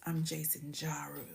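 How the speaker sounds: breathy whispered name. Pretend. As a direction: whisper it like a seductive intro. Playfully savoring viewer's name.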